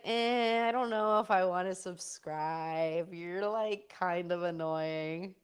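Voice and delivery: slow, drawn-out mock reluctance; imitating an unimpressed friend. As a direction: drag it out like a grudging friend. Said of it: "Jesse's like".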